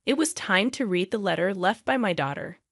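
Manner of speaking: ordinary delivery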